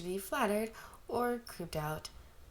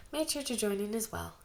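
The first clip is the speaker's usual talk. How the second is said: usual speaking voice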